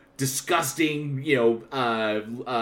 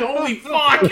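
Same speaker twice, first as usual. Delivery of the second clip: high, laughing shout of delight; excited exclamation; 14 semitones higher, 6 dB louder than usual. Retold or imitated. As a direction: blurt it out thrilled. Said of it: "I was like".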